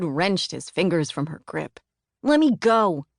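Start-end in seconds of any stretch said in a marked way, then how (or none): 2.2-3.1 put-on louder, higher shout of a struggling kid; angry boy. A narrator's reading. Boy yelling at his aunt to let go. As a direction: yell it while pulling away.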